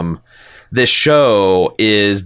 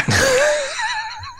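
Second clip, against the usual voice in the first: high laugh; 4 dB quieter than usual. Real, not acted. Laughs at own accent attempt.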